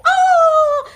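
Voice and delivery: mountain echo of a high, loud "oh"; echoed scream. A narrator's reading. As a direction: throw the scream back as an echo. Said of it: "hears a voice repeating somewhere in the mountain".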